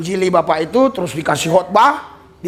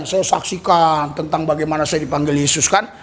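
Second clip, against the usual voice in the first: ordinary talk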